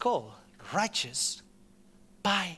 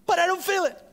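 much higher, louder mock protest; doubter's objection; 10 semitones higher, 4 dB louder, faster than usual. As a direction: mimic the whiny doubter. Voicing a doubting listener's objection.

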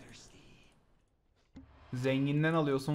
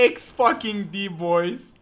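excited; 6 semitones higher, 7 dB louder, slower than usual. Real, not acted. Celebrating a play.

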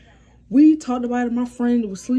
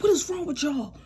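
higher-pitched imitation of a brother's scolding; mock fussing out; 8 semitones higher than usual. Brother fussing out the misbehaving pair, mock scolding. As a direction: fuss at them in a raised pitch, retelling it. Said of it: "My brother trying to fuss us out".

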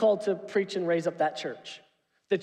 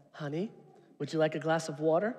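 softer, lower calming offer; a hint of a calm wife, quick and soft; gentle wife; 5 semitones lower, 5 dB quieter, faster than usual. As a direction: soothe a hot-headed husband. Wife voiced calming him.

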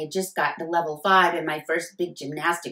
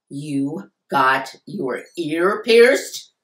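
slow, louder angry disbelief; a fuming father, louder and slow; fuming dad; 7 dB louder, much slower than usual. A narrator's reading. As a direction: let the outrage build word by word. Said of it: "Dad fumed".

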